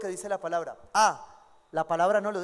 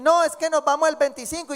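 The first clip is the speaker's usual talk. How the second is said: mock eager couple; 6 semitones higher, 8 dB louder than usual. Couple announcing rushed wedding.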